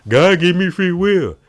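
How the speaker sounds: slow, high, loud mock proclamation; drawn-out imitation of people throwing the phrase around; mimicking believers. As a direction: quote it as a pompous slogan. Quoting people who throw that around.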